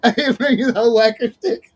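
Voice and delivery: words breaking up in laughs; laughing. Real, not acted. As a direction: giggle your way through the line. Laughing while teasing Nate.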